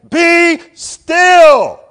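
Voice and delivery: full-force booming command, very high and loud; fortissimo shout. Shown as a demonstration. Preacher showing God's fortissimo command, shouting. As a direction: thunder it out like God commanding. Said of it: "This is fortissimo".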